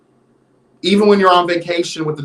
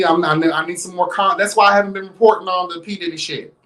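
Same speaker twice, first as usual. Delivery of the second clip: ordinary delivery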